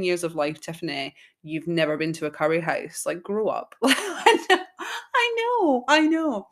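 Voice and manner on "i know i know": giggling out the words, high and loud; laughing. Real, not acted. Admitting she's never been, laughing hard. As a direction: laugh it off, higher and louder.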